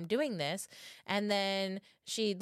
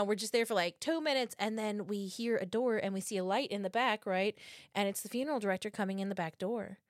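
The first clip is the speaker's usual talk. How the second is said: ordinary delivery, nothing marked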